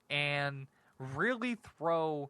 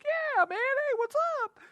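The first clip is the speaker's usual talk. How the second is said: very high, louder fangirl squeal; mock eager squeak; 4 dB louder than usual. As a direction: squeal it like a starstruck fan greeting a hero. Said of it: "And I'll be like".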